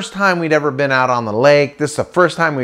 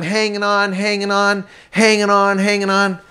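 high, tense repeated phrase; cling for dear life as you speak; acted-out strain, held high; straining to hold on; 6 semitones higher than usual. Clinging to rope again.

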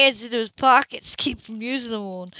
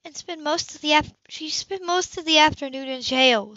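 higher, sharp retort; snapped back; 8 semitones higher, 4 dB louder than usual. A narrator's reading. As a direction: bite back defending her. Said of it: "Sybil snapped back".

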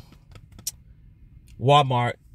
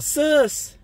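high-pitched mock call-out; retold yell; 3 dB louder than usual. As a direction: call out to someone, pitched way up but not full volume. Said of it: "and yell out".

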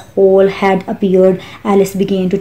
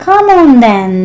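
high, loud queenly yell; narrated scream; 6 semitones higher, 6 dB louder than usual. A narrator's reading. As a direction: yell the command, pitched well up. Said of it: "screamed the queen".

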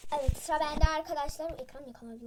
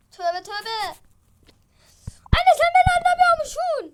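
upset shouting; 7 semitones higher, 10 dB louder, faster than usual. Acted. Upset daughter arguing with mother.

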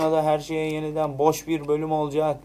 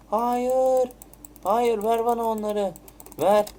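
alarmed protest; 8 semitones higher, slower than usual. Real, not acted. Item taken away in game.

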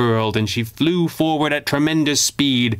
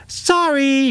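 high, bright-voiced grinning villain with a wide grin. A narrator's reading. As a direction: sing out the apology like a grinning little menace. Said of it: "he said with a grin".

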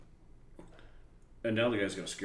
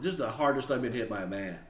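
ordinary talk

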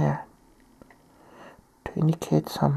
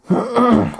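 throat clearing; 5 semitones higher, 6 dB louder than usual. Real, not acted. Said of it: "I have a little bit of cold right now".

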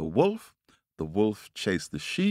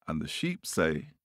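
ordinary delivery, nothing marked

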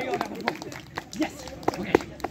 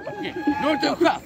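mock taunt. Teasing taunt at opponent.

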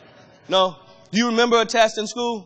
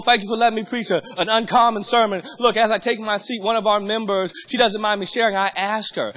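normal talking voice

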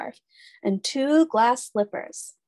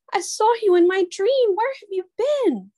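high, louder through tears; tearful; 11 semitones higher, 4 dB louder than usual. A narrator's reading. Cinderella sobbing to her long-lost grandmother, high and tearful. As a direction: let the joy and tears spill out. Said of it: "she sobbed".

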